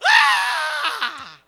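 high, loud shock scream from icy water. Retold or imitated. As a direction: yell out as the cold hits you. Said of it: "I started screaming".

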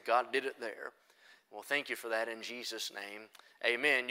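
low, soft pulpit prayer; hushed, level-pitched prayer before preaching; public prayer. Real, not acted. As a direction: pray quietly over the congregation. Prays before the sermon.

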